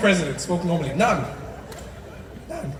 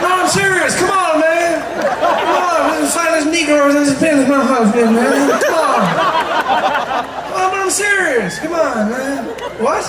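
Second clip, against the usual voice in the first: high, loud, insistent mumbler; hammed-up protesting politician; Joe Biden; 6 semitones higher, 6 dB louder than usual. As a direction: plead in a pitched-up presidential voice. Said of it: "does that weird mumbling thing".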